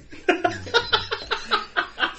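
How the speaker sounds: high breathy giggle. Real, not acted. Host cracking up at his own bit, high giggle.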